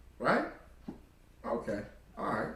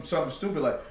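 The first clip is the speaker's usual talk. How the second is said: normal talking voice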